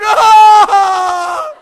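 put-on long, high, loud child's wail; drawn-out, hammed-up "Ah!" cry; mock wailing scream. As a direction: imitate a hurt child screaming. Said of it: "He's like".

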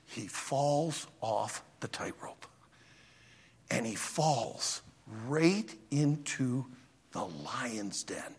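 slow, low, hushed narration; slow suspense. A narrator's reading. Preacher telling of the fall into the lions, slow and low. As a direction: draw out the fall word by word. Joke teller slows for the fall.

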